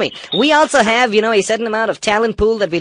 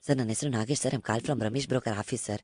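low, soft, quick broker patter; meek broker; 8 semitones lower, 13 dB quieter, much faster than usual. Acted. Prank broker greets customer, meek low murmur. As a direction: play a deferential broker on the phone.

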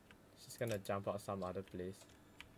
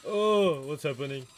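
sudden high shocked cry; startled yelp; 6 semitones higher, 14 dB louder than usual. Real, not acted. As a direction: jump at the scare. Sudden scare in horror game.

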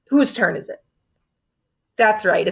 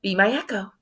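normal talking voice

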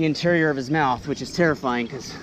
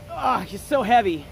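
usual speaking voice

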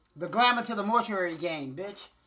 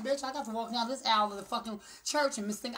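normal talking voice

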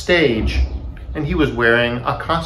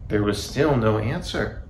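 ordinary delivery, nothing marked

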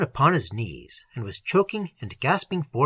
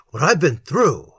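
louder, incredulous retort; indignant echo; 6 dB louder than usual. A narrator's reading. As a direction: throw the phrase back at him. Catches indignantly at the phrase.

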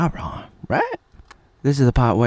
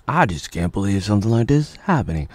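usual speaking voice

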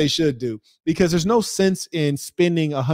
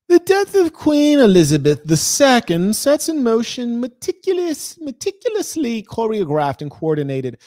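mock formal reader; 7 semitones higher, 3 dB louder than usual. Streamer reads the article on the Queen's death, mock-pompous high voice. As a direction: read it like an overblown proclamation. Article read in put-on grand voice.

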